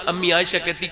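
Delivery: normal talking voice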